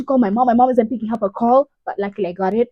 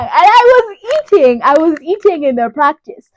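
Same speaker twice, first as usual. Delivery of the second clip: gushing with emphasis, well above usual pitch; excited bragging; 6 semitones higher, 9 dB louder than usual. Real, not acted. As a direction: belt it out proudly, pitch leaping up. Boasting how well practice went.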